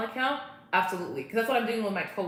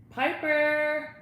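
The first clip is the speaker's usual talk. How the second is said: high, drawn-out call; 8 semitones higher, 4 dB louder than usual. Real, not acted. Calling Piper from across the room, calling out. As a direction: call the dog over.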